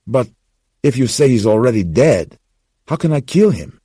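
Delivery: put-on young man begging for it not to be true; Gus, desperate. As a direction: plead in disbelief. Gus stunned by kill order.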